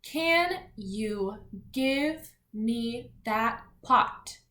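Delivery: very slow word-by-word reading; slow, separated teacher read-aloud; read-along. Shown as a demonstration. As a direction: leave space after each word for children to follow. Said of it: "let's read our words".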